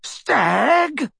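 high, incredulous roar. A narrator's reading. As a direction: throw the word back with scorn. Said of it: "roared the barman".

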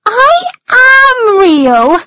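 high, slow, loud tearful protest; on the verge of tears. A narrator's reading. Alice protests to the brothers, near tears. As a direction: insist through rising tears. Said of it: "and began to cry".

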